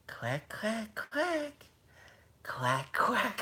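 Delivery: soft, high little quacks; imitated duck quacks. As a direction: quack like the ride lady leading children. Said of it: "she starts going".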